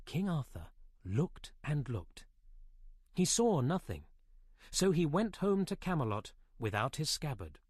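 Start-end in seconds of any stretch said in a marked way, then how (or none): none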